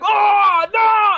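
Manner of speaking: high shouted gamer overreaction; mock gamer shriek. Mocking annoying gamers who get shot, high shout. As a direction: ham up a gamer getting shot. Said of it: "their voices are so fucking annoying".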